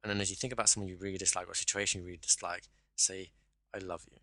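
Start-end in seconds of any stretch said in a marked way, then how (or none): 3.7-4.2 loveless, wrong-sounding. Shown as a demonstration. Coach thinking of someone disliked, saying it without love. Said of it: "It comes across wrong".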